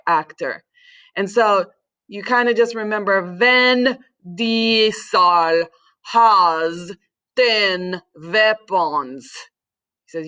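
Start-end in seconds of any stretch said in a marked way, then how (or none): 3.4-9.3 very slow, each syllable of VIN DIESEL HAS TEN WEAPONS set apart; mnemonic pronunciation. Shown as a demonstration. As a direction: spell out the mnemonic slowly, WEAPONS starting with a V. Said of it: "have to say it like this".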